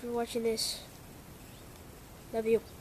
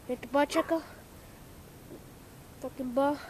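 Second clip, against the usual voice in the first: ordinary talk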